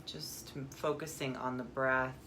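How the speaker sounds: calm meditation guide, long pauses; guided meditation. Acted. Guide turns listeners inward to the breath, calm meditation voice. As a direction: ease them into stillness, phrase by phrase.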